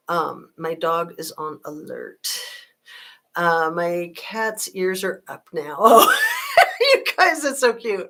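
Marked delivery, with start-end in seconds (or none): 5.8-6.5 sudden high squeal of a laugh; high burst of laughter. Real, not acted. Streamer laughs at her cat perking up its ears.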